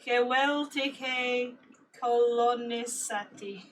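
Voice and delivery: drawn out word by word; very slow syllables. Shown as a demonstration. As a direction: spell it out slowly for guessing. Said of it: "guess it from what I'm saying".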